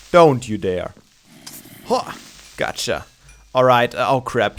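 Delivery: ordinary talk, nothing marked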